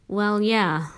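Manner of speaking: ordinary delivery, nothing marked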